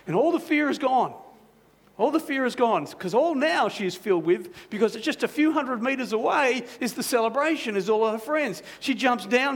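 rising joy. A narrator's reading. Joy after the bridge.

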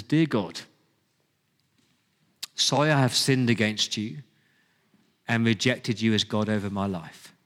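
low, measured prayer with long pauses; read-out prayer. Shown as a demonstration. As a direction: pray it phrase by phrase, pitch falling. Reads a prayer aloud.

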